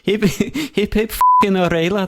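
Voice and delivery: sweary Scouse cheer; Scouse accent. Acted. Co-host shows off a hip hip hooray, Scouse accent. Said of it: "you could do a Scouse accent".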